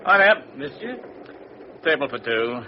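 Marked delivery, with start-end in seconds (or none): 0.0-1.0 higher and louder, breezy call; hailing the steward. Acted. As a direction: summon the steward across the car.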